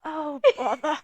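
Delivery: lightly voiced raspy little bear; a hint of Pooh; Winnie the Pooh voice. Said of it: "I don't think that was right either".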